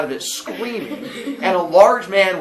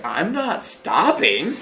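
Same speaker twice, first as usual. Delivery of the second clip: drawn-out slow reply; brother's mock determination; 3 semitones higher, much slower than usual. Fleeing brother answering the caught boy, slow mock determination. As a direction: stretch it out, played for laughs.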